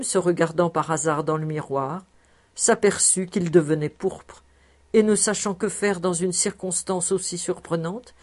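ordinary delivery

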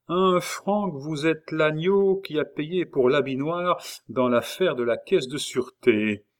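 usual speaking voice

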